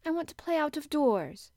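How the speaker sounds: put-on anxious girl speaking up; holding back trembling. A narrator's reading. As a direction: keep a nervous voice steady. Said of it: "hoping that her voice did not tremble".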